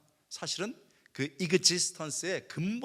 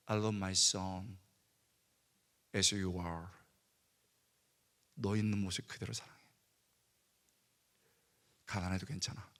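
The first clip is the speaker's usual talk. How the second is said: tender divine voice; 8 semitones lower, 7 dB quieter than usual. Retold or imitated. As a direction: speak as a loving father to his son. God's answer voiced.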